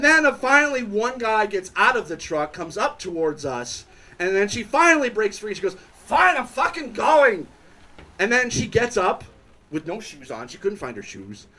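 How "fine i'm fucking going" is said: high-pitched imitated outburst; mock exasperated yell. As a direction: give in irritably, much higher than usual. She snaps at truck man, retold.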